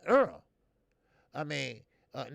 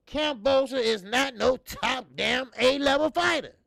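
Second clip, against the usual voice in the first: loud, heated dismissal of the fighter; loud rant; 10 semitones higher, 10 dB louder than usual. Real, not acted. Host ranting to viewers about Kambosos, loud and heated. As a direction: raise your voice and run him down.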